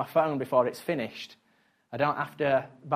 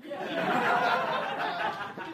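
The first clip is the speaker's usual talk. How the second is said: a long high unvoiced burst of laughter; high giggling laugh that falls away; real high laugh; 5 dB quieter than usual. Real, not acted. Laughing at wife's joke.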